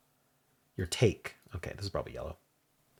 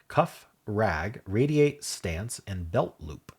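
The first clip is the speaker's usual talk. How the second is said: ordinary delivery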